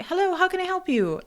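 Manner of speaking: high, quick service greeting; drive-thru worker. Retold or imitated. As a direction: imitate a high-pitched order taker. Voices drive-thru worker taking order.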